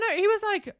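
ordinary talk

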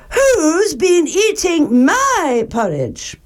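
high, slow, indignant mother bear. A narrator's reading. Mother Bear at her bowl, high-pitched dismay. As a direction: lift it high and draw out the question. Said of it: "Then Mother Bear looked".

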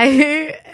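burst of laughter. Real, not acted. Laughs at ex's fate.

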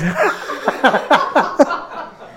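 airy, high chuckle; breathy laugh. Real, not acted. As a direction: laugh silently at your own joke. Laughs at own joke.

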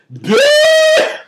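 high shrieking burst of laughter; loud squealing laugh. Real, not acted. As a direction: cackle at the memory. Horrified laugh at friend's face.

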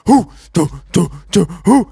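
short hooted troop grunts; imitated marching chant. National Guards advancing on the crowd, imitated marching chant. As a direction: mimic soldiers' rhythmic marching calls. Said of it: "the fucking National Guards are".

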